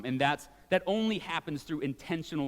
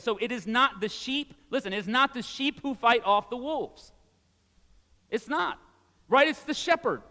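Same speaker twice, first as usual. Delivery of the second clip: rising, louder pastor's proclamation; preaching at full force; 5 semitones higher, 4 dB louder than usual. Acted. Pastor urging the congregation, preaching at full force. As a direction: drive the point home from the pulpit.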